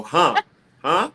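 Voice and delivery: loud, puzzled huh; confused audience. Retold or imitated. Audience failing to hear a mumbler, loudly puzzled. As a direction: blurt it like someone who missed the words. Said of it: "make people go".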